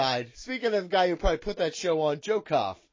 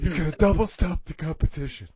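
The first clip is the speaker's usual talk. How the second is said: Big Johnny voice; 2 semitones lower than usual. Acted. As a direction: lecture him in character, cocky. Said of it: "but Big Johnny".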